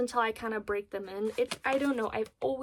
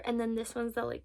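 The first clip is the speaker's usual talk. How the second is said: ordinary talk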